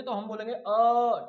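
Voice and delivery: अ stretched three times as long; prolonged a. Shown as a demonstration. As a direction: hold the अ long. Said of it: "अ को तीन गुना समय तक बोलेंगे". (अ लंबा खींचकर)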